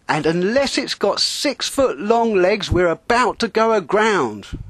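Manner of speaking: high, loud shouted warning; angry shout. Acted. Skipper yelling a warning about running aground. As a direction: shout it, still furious. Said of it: "he added".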